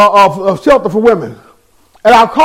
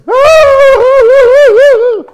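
high, loud mock crying wail; imitating the friend's crying; mock hysterical wail; 12 semitones higher, 4 dB louder than usual. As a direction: wail like she did on the phone. Mimics her hysterical crying.

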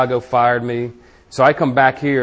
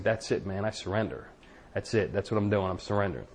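hushed surrender; 4 semitones lower, 11 dB quieter than usual. Retold or imitated. Retold surrender at church.

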